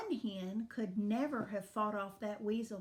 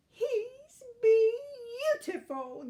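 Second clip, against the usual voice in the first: high, loud, drawn-out awe; gasped; 13 semitones higher, 8 dB louder, much slower than usual. A narrator's reading. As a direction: gush slowly in amazed delight. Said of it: "gasped Zinnia".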